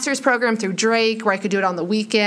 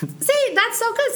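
much higher, thrilled squeal of praise; delighted praise; 13 semitones higher, slower than usual. Real, not acted. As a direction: gush over how good her answer was. Impressed by friend's spin.

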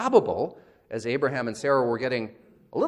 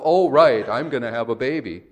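loud scoffing quote, falling away; mocking sarcasm; 8 dB louder than usual. The visitor quoting Sarah's scoff to Abraham, sarcastic. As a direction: mimic her disbelief.

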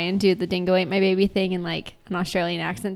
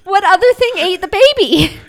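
loud, high-pitched protest; indignant, high and very loud; exasperated outburst; 11 semitones higher, 13 dB louder than usual. Real, not acted. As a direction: shout it back in exasperation. Mock quarrel over the word game.

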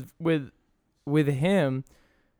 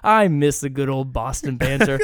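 retold nostalgia; 8 dB louder than usual. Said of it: "Katie was like".

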